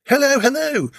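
high sing-song hello; calling out. Acted. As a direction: call out cheerfully from the door. Estate agent calling out greeting.

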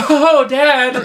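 high laughing "Oh, Dad" as if caught off guard; laughing mock startle. Daughter startled by her sneaking dad, laughing. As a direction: squeal it out through a laugh.

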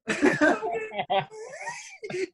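long high real laugh; high helpless laugh. Real, not acted. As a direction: burst out laughing. Laughing at his own story.

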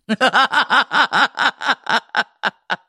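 long high giggling laugh; real delighted laugh; burst of laughter. Real, not acted. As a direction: crack up helplessly. Laughing at nutshot videos.

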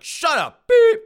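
very high, very loud outburst; flustered shout. Acted. Embarrassed son shutting up his girlfriend, flustered shout. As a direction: yell them down, mortified. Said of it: "So Andrew's just flustered".

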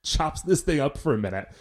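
normal talking voice

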